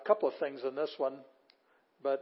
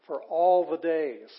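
ALL drawn out, loud; slow, louder "all the days", leaning on "all"; emphatic stress on ALL; 3 semitones higher, 9 dB louder, slower than usual. Shown as a demonstration. Stressing duration of the phrase.

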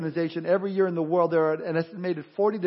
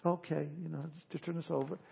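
much softer, meek mock request; mock mild; 13 dB quieter than usual. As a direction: play the timid polite version he was not. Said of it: "He's not".

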